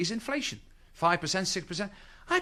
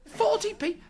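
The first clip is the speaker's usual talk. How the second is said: incredulous echo; slower than usual. Pretend.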